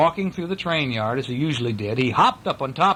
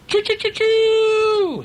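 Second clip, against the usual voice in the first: very high, loud, long-held choo; snorted train choo; 5 dB louder, much slower than usual. A narrator's reading. Small yellow engine overhearing the friends, loud high snorted choo. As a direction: hoot loudly like a train whistle. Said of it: "he snorted".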